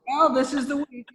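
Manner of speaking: high, put-on mimicked voice; Lauren. Boss answering a hopeless pitch, high mimicked voice. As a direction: lift the voice high for a mocking deadpan verdict. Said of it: "And then Lauren goes".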